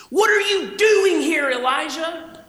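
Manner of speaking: high, loud, slow accusing question; loud demanding take. Shown as a demonstration. God demanding of Elijah, high and loud. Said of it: "How did the Lord say this?".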